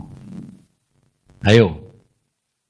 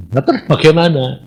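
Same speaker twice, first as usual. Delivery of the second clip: ordinary talk, nothing marked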